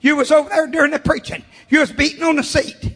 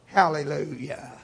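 soft hallelujah; 10 semitones lower, 9 dB quieter than usual. Real, not acted. Softly after shouted hallelujah.

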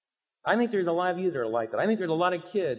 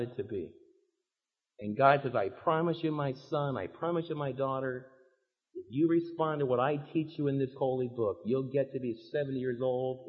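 soft, lower tender promise; gentle promise; 6 semitones lower, 6 dB quieter than usual. Retold or imitated. Preacher voices God promising his children, soft and tender. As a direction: speak like a loving father.

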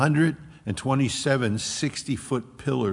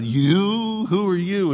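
high, louder demon cry; hostile demon; 8 semitones higher, 5 dB louder, slower than usual. Acted. Demon turning on the sons of Sceva, high and menacing. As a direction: hurl the question as a menacing demon. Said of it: "this wasn't a friendly sound".

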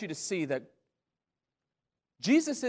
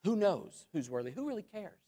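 cynical; 8 dB quieter than usual. Acted. Preacher imitating the cynical answering the angel, faint cynical grumble. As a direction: toss it off faintly, as if unimpressed. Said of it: "can you imagine the cynical".

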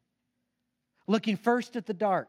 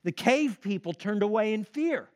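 ordinary talk, nothing marked

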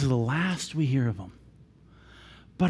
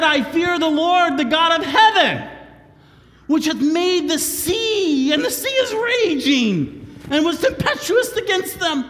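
preaching at full force; 12 semitones higher, 5 dB louder than usual. Acted. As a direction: thunder the verse out. Repeats Jonah's words at full force.

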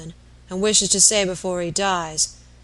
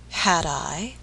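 ordinary delivery, nothing marked

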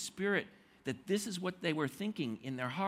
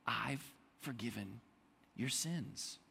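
soft gentle forgiveness; 6 semitones lower, 6 dB quieter than usual. Retold or imitated. Jesus looking at the man, quietly tender. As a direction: lower and softer, tender.

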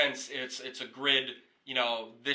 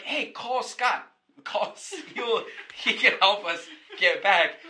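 put-on high, laughing, drunken plea to call Scott; tipsy excited call; 7 semitones higher, 5 dB louder, slower than usual. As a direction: giggle through a drunk request to call Scott. Drunk friend calling out, laughing.

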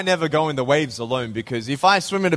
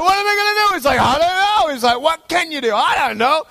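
much higher, frantic inner voice; mock panic; 4 dB louder than usual. Imitating himself trapped by the wave, mock panic. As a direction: squeak it like you're helpless.

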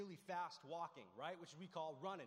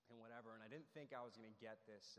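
ordinary talk, nothing marked

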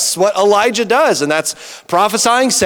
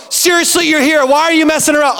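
exasperated neighbor; 7 semitones higher than usual. Retold or imitated. Neighbors annoyed, insisting.

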